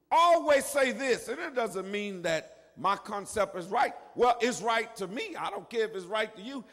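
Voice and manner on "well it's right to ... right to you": ordinary talk, nothing marked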